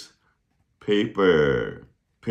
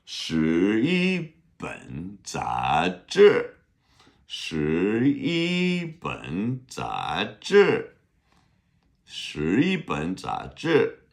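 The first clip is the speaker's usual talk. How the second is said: 十一本杂志 said very slowly, syllable by syllable; teacher drills 十一本杂志 slowly; slow model phrase; much slower than usual. Shown as a demonstration. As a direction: repeat 十一本杂志 slowly for the class.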